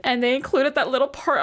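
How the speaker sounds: ordinary talk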